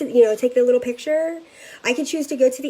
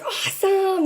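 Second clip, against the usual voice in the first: excited awesome; 9 semitones higher, slower than usual. Retold or imitated. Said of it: "she was like".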